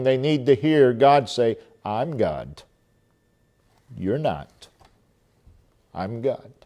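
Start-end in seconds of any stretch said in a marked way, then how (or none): 1.8-6.5 hushed voice of God. Acted. Preacher voices God's rebuke.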